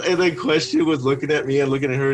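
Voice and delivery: normal talking voice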